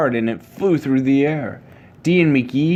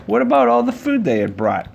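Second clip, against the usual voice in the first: surprised thought; 10 semitones higher than usual. A narrator's reading. Narrator voices Dee's sudden thought.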